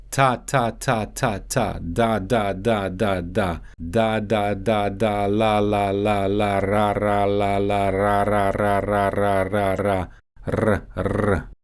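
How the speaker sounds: ta, da, la, ra drill sliding to a Russian rolled r; tongue drill syllables. Shown as a demonstration. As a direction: tap the tongue on the ridge, ta to ra. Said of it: "Put the tip of your tongue on the alveolar ridge".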